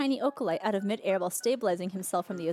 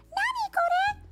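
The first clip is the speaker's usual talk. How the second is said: high, lightly voiced anime scream; anime girl scream; slower than usual. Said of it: "let out a scream".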